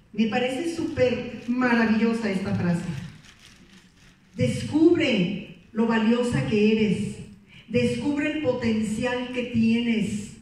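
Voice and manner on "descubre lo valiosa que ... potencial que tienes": slow emphatic slogan. Shown as a demonstration. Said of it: "motivational slogans spelled out".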